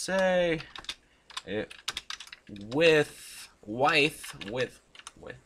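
one word at a time, long gaps; halting, broken-up dictation; typing along. Real, not acted. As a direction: sound out each word as you type. Sounds out words while typing.

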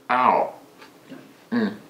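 chewing while speaking; talking around food; mouth full. Real, not acted. Seen 2: fork into mouth, then lips closed chewing during words.

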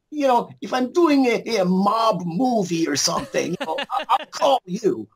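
high mock Russian accent for the German director. Director dismissing the actor at a casting, Russian accent. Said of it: "I'm doing, like, a Russian accent".

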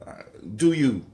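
usual speaking voice

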